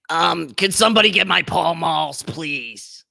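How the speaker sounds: put-on old smoker voice. Plays Brenda asking for cigarettes.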